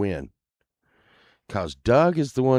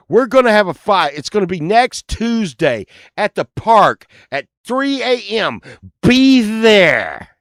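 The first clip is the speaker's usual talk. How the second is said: mock formal challenge; 7 semitones higher, 6 dB louder than usual. Mock scheduled fight challenge.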